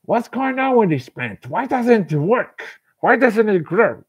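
high, loud, exasperated questions; mock the impatient gardener; puzzled impatience. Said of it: "It will seem that".

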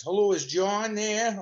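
Buddy Hackett. Retold or imitated. Famous comic phones the house asking for John, slow put-on voice. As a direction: drag the greeting out like the famous comic. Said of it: "Never forget that voice".

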